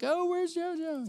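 high, drawn-out singsong calling; slow, high-pitched pretend searching; playful parent seeking toddler. Parents playfully seek hiding toddler.

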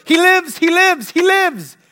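high, loud repeated proclamation; re-enacted preacher's victory cry; triumphant shout. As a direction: shout it out in faith, each time full voice. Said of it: "and I shouted".

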